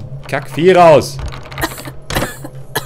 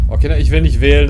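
ordinary talk, nothing marked

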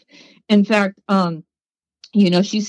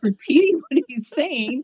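laughing; 5 semitones higher than usual. Real, not acted. Laughing at her own story.

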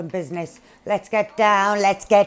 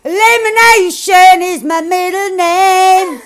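cocky boast; 10 semitones higher, 15 dB louder, slower than usual. Real, not acted. Boasting after kills.

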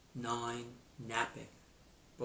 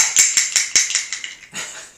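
laugh; 12 dB louder than usual. Real, not acted. Delighted by the clapper toy.

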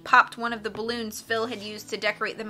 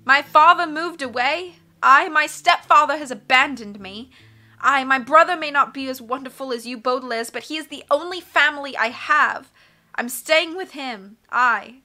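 put-on high, loud, emotional girl's voice; distressed mycologist girl; 5 semitones higher, 8 dB louder than usual. Fiona choosing her brother over the Baudelaires, distressed. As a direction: declare each loss with rising insistence.

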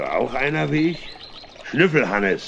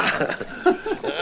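breathy laugh. Acted. Stumbling with the pram, laughs.

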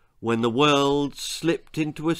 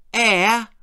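sly; 8 semitones higher, 5 dB louder than usual. Acted. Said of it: "replied Willard slyly".

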